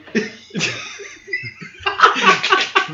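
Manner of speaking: wheezing laugh. Real, not acted.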